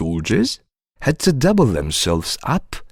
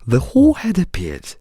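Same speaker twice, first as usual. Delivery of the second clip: plain reading voice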